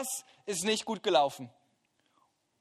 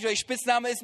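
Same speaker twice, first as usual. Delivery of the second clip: ordinary talk, nothing marked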